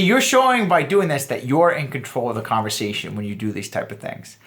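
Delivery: usual speaking voice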